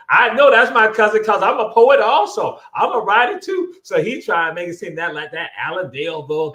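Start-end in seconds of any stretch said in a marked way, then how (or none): 0.1-3.7 boasting president. Retold or imitated. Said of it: "gonna say".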